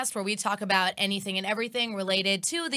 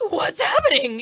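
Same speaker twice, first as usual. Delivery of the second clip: higher, louder, frantic question; mock alarm; 8 semitones higher, 9 dB louder than usual. Jealous boyfriend seeing her text a dude, hammed-up alarm. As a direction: panic over her phone, hammed-up. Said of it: "and you're like".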